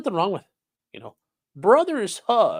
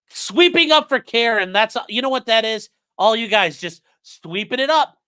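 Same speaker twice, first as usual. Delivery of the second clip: loud, higher teasing call-out at chat; mock accusation; 7 semitones higher, 7 dB louder than usual. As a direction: call the chat out, half joking.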